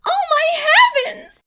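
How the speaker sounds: high, loud shriek of shock; mother's cry. Acted. Mother shrieking at her striped daughter, high and loud. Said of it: "she cried".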